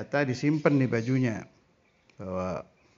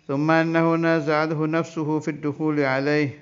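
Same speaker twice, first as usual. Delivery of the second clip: kitab recitation; 2 semitones higher, 4 dB louder than usual. Acted. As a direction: intone the Arabic text like a pesantren teacher. Reads Arabic kitab text aloud.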